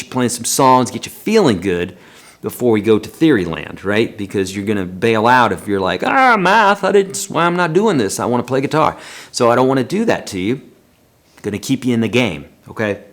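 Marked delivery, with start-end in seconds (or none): 6.0-9.0 high-pitched balking at math, hammed-up; mock student protest. Imagined student bailing out over theory, mock protest. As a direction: groan "Ah" high, then object as a reluctant pupil.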